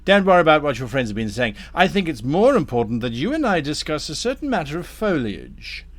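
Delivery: courteous tempter with swooping emphasis; lightly voiced scheming Damnifer. Damnifer turns Thorn from friends to foliage, lightly voiced courteous schemer. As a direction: steer them smoothly towards business. Game master plays Damnifer.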